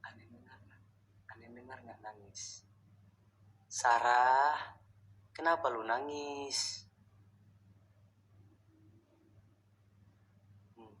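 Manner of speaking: hushed, breathless whisper to the dark; fearful whisper. Real, not acted. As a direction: whisper so nothing hears you. Whispering to unseen crying ghost. (berbisik ketakutan)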